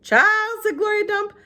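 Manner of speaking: a hint of elderly Southern woman; old woman Gloria. A narrator's reading. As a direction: ask it like a kindly old lady. Gloria voiced by narrator.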